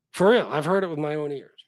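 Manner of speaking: ordinary delivery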